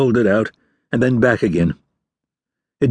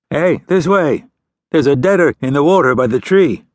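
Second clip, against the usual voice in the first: raised, higher call to the fishermen; 5 semitones higher, 4 dB louder than usual. Acted. Boy calling the fishermen over to the floating corpse, loud call. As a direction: shout them over to the river.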